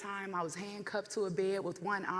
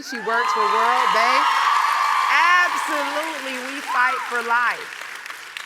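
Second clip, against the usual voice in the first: very loud, higher, slowed-down rally call; loud slow proclamation; 4 semitones higher, 14 dB louder, slower than usual. Real, not acted. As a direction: drive the slogan home to the crowd. Rallying cry to audience.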